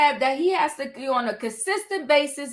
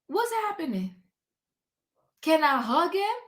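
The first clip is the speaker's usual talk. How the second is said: retold confusion; 3 semitones higher, 6 dB quieter than usual. Host retelling her confused reaction at the gala, mock bewildered. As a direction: act baffled, as if caught off guard. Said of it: "I said".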